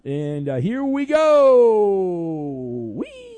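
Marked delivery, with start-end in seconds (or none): 0.6-2.9 loud, high announcer holler with a long drawn-out GO; big showy game-show call; hyped game kickoff. Acted. Launches the game segment. 2.9-3.3 very high, squealed "whee"; hammed-up ride cheer; mock-gleeful whee. Mock cheer after launching game.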